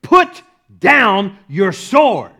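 slow, booming order, word by word; loud command. Retold or imitated. Jesus commanding Peter in the garden, loud command. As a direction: order it forcefully, every word separate. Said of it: "he commanded Peter".

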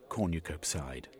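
usual speaking voice

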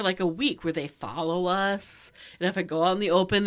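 usual speaking voice

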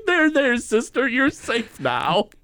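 high, slow hammed-up big-brother comfort; mock-soothe your little sister; comforting Bart. Bart comforts Lisa for Homer's benefit, high hammed-up soothing. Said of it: "he's like".

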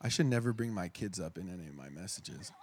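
real tears welling, voice dropping low and soft; low, hushed, on the verge of crying; choking up. Real, not acted. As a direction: fight back tears, barely getting the words out. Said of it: "I'm gonna cry".

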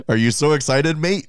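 a half-hearted stab at an Australian accent; attempted Australian accent. Shown as a demonstration. Podcast co-host trying the challenge, weak Australian accent. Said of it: "try to say a full sentence with an Australian accent".

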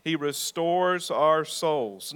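slow, deliberate, each word weighed; slow repeated declaration. Shown as a demonstration. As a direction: say it slowly for all to repeat. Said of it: "Let's say it again".

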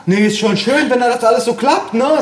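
put-on high-pitched old-lady chatter; contented grandma. A narrator's reading. Grandma missing the point, high and content. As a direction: chirp along obliviously as granny.

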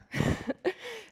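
high breathy giggle. Real, not acted. Preacher admitting she cries a lot, a high giggle. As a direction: laugh at yourself. Laughing at own crying.

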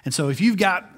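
ordinary talk, nothing marked

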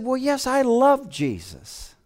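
imitating the cashier gently; young woman's voice.

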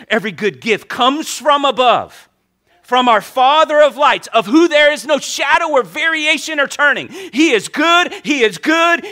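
high, loud, building proclamation; full-force pulpit shout; fervent preaching. Real, not acted.

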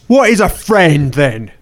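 ordinary talk

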